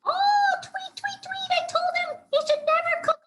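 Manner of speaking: very high, fast chirpy bird; tiny panicky cartoon bird; fretting bird. A narrator's reading. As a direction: tweet it out in a rushed falsetto. Bird character frets in story.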